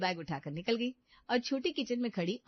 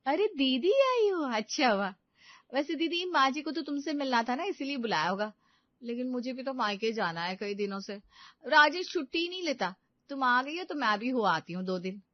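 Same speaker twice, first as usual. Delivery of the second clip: sly daughter-in-law; 5 semitones higher than usual. Acted. Meenu's voice, higher.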